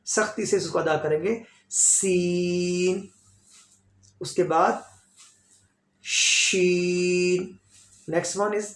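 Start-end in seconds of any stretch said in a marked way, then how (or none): 1.7-3.0 "seen" stretched with a whistling s; long whistled seen. Shown as a demonstration. Teacher models the stretched letter for students, long and hissed. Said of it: "इसको थोड़ा खींचना है". 6.0-7.6 slow "शीन" with a long sh; drawn-out sheen. Shown as a demonstration. Teacher pronounces the letter for students, slow and long. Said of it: "like S H sound".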